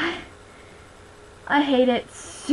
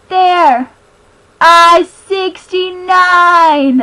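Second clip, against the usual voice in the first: excited shout; 4 semitones higher, 12 dB louder, much slower than usual. Real, not acted. Finds the passage in the book.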